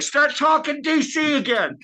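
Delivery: high, drawn-out imitated call; mock heckling call. Imitates people honking from van.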